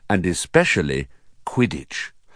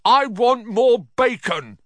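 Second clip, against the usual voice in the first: very high, slow bratty demand; demanding yell; 14 semitones higher, 4 dB louder than usual. A narrator's reading. Spoilt son wanting food at breakfast, demanding loudly.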